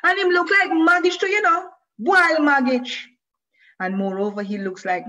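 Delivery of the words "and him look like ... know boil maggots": high, loud gleeful taunt; child's shout in patois. A narrator's reading. Boy shouting insults about Moshe while leaping for the ball, high and loud. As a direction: crow the insult to the sky. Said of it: "shouting into the air".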